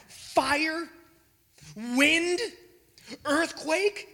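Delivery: awed exclamation. Real, not acted. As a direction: marvel at the power. Exclaiming God's might.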